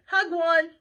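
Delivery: high, loud waitress holler; short order yelled out. Acted. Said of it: "the waitress hollered".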